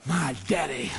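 low, softened refrain with a pause midway; quiet assurance. Real, not acted. Preacher answering sickness's threat, quiet confident voice.

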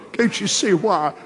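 usual speaking voice

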